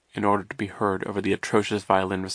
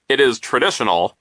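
loud, higher, pompous reprimand; stern; 4 semitones higher, 8 dB louder than usual. A narrator's reading. Vice principal lecturing children who have not clapped, stern. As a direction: insist on it sternly and loudly. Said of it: "he said sternly".

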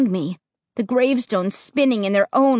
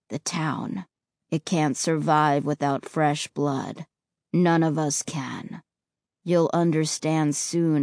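low, flat tone; 9 semitones lower, 6 dB quieter than usual. Acted. Ray voiced low.

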